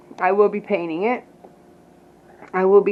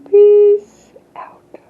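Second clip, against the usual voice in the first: high whispered sign-off; 11 semitones higher, 9 dB louder than usual. Real, not acted.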